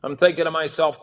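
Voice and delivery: normal talking voice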